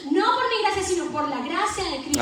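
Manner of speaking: rising pulpit exhortation; fervent preaching. Acted. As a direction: preach it to the congregation with fire. Preaching at full force.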